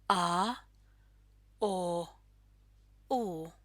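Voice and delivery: a, o, u as separate back vowels. Shown as a demonstration. Teacher showing learners the back vowels a, o, u, separately sounded. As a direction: sound out Finnish a, o, u one by one. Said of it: "pronounced toward the back of the mouth".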